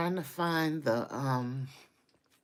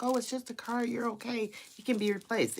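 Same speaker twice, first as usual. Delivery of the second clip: lightly mimicked higher-pitched placating voice; officers' reassurance; 8 semitones higher, faster than usual. Retelling officers telling her it's just a car, mock-reassuring. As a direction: imitate someone brushing it off, pitched up. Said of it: "the officers was like".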